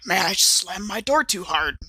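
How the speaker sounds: ordinary delivery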